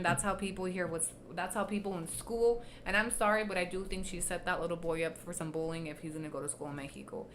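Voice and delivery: ordinary talk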